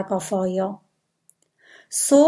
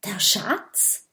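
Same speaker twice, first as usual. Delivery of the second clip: excited; 3 dB quieter than usual. A narrator's reading. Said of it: "fragt Kokosnuss aufgeregt".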